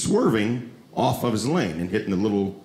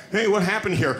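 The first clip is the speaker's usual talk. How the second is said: lightly voiced sheriff, raised pitch; questioning sheriff; 6 semitones higher than usual. Sheriff asking crash victims, raised-pitch questioning voice. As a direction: ask like an official arriving on the scene. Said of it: "They're gonna be like".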